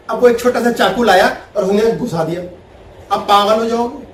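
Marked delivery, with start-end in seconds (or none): none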